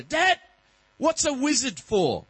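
put-on high, loud and slow little boy; child's question. Son quizzing dad at bedtime, high slow child voice. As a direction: ask it like a wide-eyed kid.

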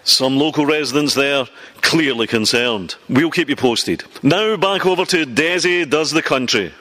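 formal anchor sign-off; mock news read. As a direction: wrap up like a reporter handing back to the studio.

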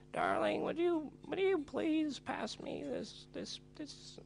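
hammed-up old maid asking sweetly; mock posh old lady. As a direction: falsetto posh old dear voice. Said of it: "and be like".

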